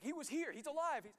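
high, loud breathless-news imitation; excited and confused. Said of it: "they're just excited and confused".

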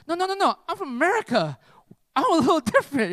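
high, excited interjection; eager protest. Retold or imitated. Eagerly sets himself apart to strangers.

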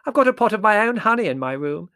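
loud, high oblivious interjection; booming high aunt voice; hard-of-hearing aunt. A narrator's reading. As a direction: chime in loudly as if deaf. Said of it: "said Aunt Fan".

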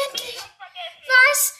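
high, loud yelling with pure joy; ecstatic screaming. Real, not acted. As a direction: scream it out, you finally won. Jubelt weiter. (völlig ekstatisches Schreien)

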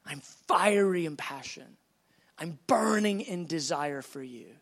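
low, intense declaration of love; impassioned voice of God. Acted. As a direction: keep it low and let each phrase burn. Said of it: "He goes".

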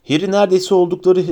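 ordinary delivery

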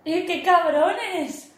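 slow outraged exclamation; drawn-out shocked cry; indignant. Real, not acted. Outraged at the fake socket.

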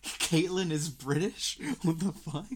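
loud, high, drawn-out disbelief; retold outraged double take, laughing; mock shock. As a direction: re-enact a flabbergasted tweet. Acts out own shocked tweet.

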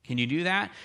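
ordinary talk, nothing marked

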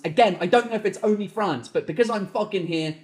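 ordinary delivery

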